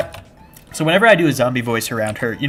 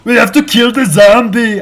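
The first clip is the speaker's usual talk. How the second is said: much higher and louder, slow, bellowing action-hero yell; Arnold Schwarzenegger impression; 10 semitones higher, 12 dB louder, much slower than usual. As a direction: bark it like a movie action hero. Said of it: "I did become Arnold Schwarzenegger".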